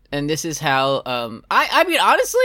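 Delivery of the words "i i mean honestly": squeaky high voice. Pretend. Sudden high squeaky aside.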